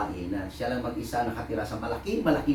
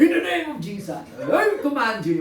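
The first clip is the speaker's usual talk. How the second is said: hammed-up commanding yell, much higher and louder; mock shouting exorcist; 13 semitones higher, 5 dB louder than usual. Pastor mocking shouting exorcists, hammed-up high yell. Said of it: "sisigaw-sigaw ka".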